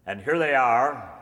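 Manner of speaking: big introduction. Real, not acted. Announcer bringing the final couple on stage, loud showman call. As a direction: announce the arrivals with a flourish.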